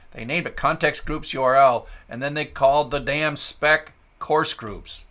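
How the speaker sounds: loud, exasperated complaint. Real, not acted. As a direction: vent at the spec. Annoyed ranting at the spec.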